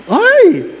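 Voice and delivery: sudden high yelp of alarm; jokingly alarmed outburst; mock startled cry. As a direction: gasp it out like you just saw a ghost. Priest's mock alarm in story.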